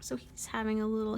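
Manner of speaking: usual speaking voice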